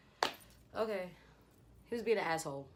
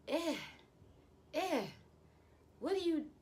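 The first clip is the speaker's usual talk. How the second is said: high-pitched mock yelling; mock screaming; 9 semitones higher than usual. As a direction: imitate a worked-up boyfriend, high and strained. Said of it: "this boy was screamed to the top".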